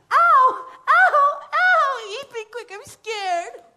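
high, loud ow-ow cries; scared girl cries. Acted. Girl inside the drum crying out in fright.

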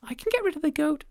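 very high, piping call; a tiny creature calling up; squeaky tiny voice; tiny ant voice. Acted. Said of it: "said the ant".

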